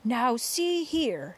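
shouting. A narrator's reading. Chief of police challenges the creature, mild authoritative shout. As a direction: call up to them with slow authority. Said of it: "shouted the chief of police".

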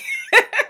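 a sudden high-pitched burst of laughter; high squealing laugh. Real, not acted. Laughing at frustration.

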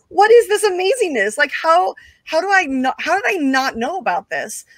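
high, awed excitement; amazed. Retold or imitated. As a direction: gush in wonder at the spectacle. Amazed at wrestling-style show.

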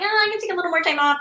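high, quick mock self-indulgent excuse; lightly mocked wheedling to oneself; excuse-making. Voicing own excuse after vacation.

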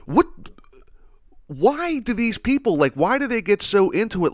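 venting disbelief at superfans; exasperated rant. Real, not acted. Ranting at metal fans.

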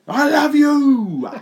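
very high, loud, drawn-out mock "I love you"; mock declaration. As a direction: croon it like a lovesick parody. Voicing commentator's affection.

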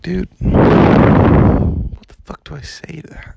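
high, loud, slow incredulous cry; exasperated outburst. Real, not acted. Vlogger reacting to dad's demand, loud disbelief. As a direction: throw it out in disbelief.

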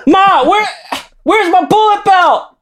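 high, slow wailing yell to mom; yelling across the room. Retold or imitated. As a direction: whine upstairs at full volume. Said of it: "forty-five-year-old thrash kids ... in their mom's basement".

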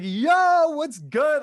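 high, loud excited yo; yelling hello over a bar crowd; hyped-up greeting. Retold or imitated. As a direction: greet them all pumped up. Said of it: "I'm, like, hyped up".

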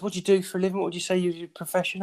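high-pitched imitation of a caller's questions; imitated phone interviewer. Plays the tracing caller's questions.

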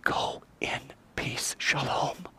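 slow, whispered blessing; tender whisper. Acted. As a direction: send her off in a gentle whisper. Jesus gently sends her away.

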